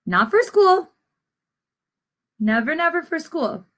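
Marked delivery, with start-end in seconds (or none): none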